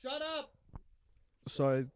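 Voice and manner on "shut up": high yell at someone far off; shouting across the house. Pretend. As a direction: holler at her from the couch. Yelling at wife offstage.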